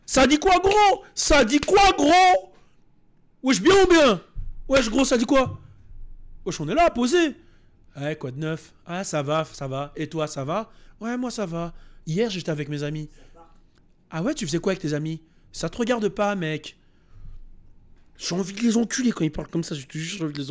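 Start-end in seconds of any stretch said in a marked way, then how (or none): 0.1-7.4 loud, high wesh-style street talk; street slang greeting. Retold or imitated. Showing how the dub should sound in slang. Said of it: "on traduit ça bien". 8.0-16.8 soft, low, stiff dubbed-style exchange; stilted dub dialogue. Retold or imitated. Mocking the soft, stiff way the dub would say it. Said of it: "Genre, non".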